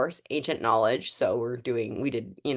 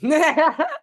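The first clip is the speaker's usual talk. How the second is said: high, loud burst of laughter; 13 semitones higher, 6 dB louder than usual. Real, not acted. Host shrugging off a spider on camera, bursting laugh.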